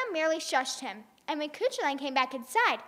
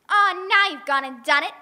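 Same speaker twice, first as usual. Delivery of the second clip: fussy mother voice; 5 semitones higher, 8 dB louder than usual. Acted. Una scolds about baby.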